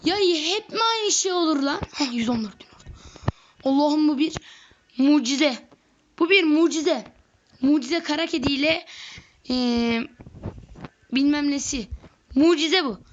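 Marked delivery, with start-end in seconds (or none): 0.0-1.8 exasperated. Real, not acted. Exasperated at repeat bug. 3.6-13.0 excited disbelief. Real, not acted. Thrilled at huge army.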